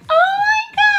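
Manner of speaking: very high, squealing "Oh my God"; excited squeal. Retold or imitated. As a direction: squeal it out like a giddy teen. Inner reaction on crowded train.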